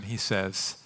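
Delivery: normal talking voice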